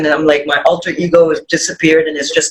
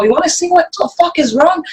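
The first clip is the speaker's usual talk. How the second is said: retold snap; 9 semitones higher, faster than usual. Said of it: "And I just go".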